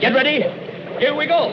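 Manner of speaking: brace everyone before the plunge; calling out. Acted. Steering boat under arch.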